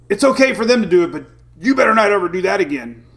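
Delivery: mock dad yell. Dad yelling at daughter Emily over wild pitches, a mock yell. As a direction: bark it like a fed-up baseball dad. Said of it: "I yelled at Emily".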